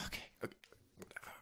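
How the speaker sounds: barely audible, whispered self-talk; collect yourself under your breath; very soft, almost voiceless; muttered to himself. Real, not acted. Collecting himself after choking up.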